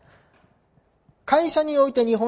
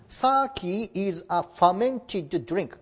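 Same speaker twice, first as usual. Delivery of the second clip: slow model reading; much slower than usual. Shown as a demonstration. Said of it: "model reading English sentence".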